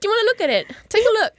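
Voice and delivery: high, put-on cheeky voice offering a look; a cheeky high joking voice; mock cheeky offer. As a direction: tease them with a high silly voice.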